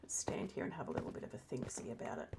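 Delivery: hushed playful whisper. Real, not acted. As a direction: whisper it like a secret. Playful aside to viewers.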